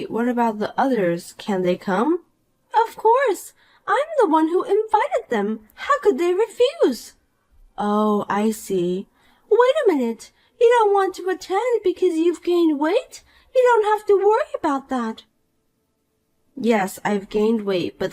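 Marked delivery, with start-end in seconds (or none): 2.9-7.1 put-on bright, higher voice of a smug mean girl; snide rich girl. Poppy boasts. 9.5-15.2 put-on bright, higher voice of a smug mean girl; snide rich girl. Poppy mocks weight.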